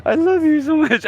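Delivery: high-pitched, hammed-up tearful gushing; mock crying. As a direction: pretend to burst into tears, voice way up high. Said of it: "start crying and being like".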